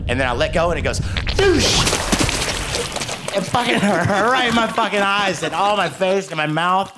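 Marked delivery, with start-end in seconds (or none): 1.0-3.3 explosive, high-pitched whoosh noise; imitated splash burst. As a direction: make the sound of liquid bursting out. Imitated burst of spurting liquid.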